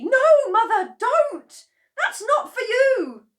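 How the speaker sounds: very high, very loud cry; cried out. A narrator's reading. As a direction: stop her in panic. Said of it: "cried Missus Cranky".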